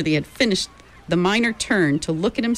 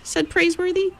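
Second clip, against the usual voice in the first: ordinary delivery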